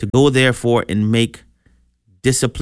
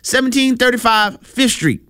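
high-pitched, hammed-up excited townsman; acted excitement, voice pitched way up; excited onlooker; 10 semitones higher than usual. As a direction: play an amazed onlooker spreading news. Acting out onlookers eager to see Lazarus.

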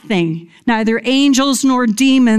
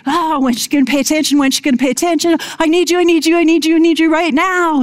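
put-on fast, higher-pitched pestering child; whining impatient kids; 6 semitones higher, much faster than usual. Kids pestering their mother for attention, whiny and insistent. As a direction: nag urgently like kids wanting mom's attention, rushed. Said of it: "like my kids".

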